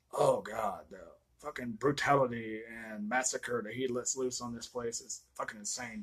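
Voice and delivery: usual speaking voice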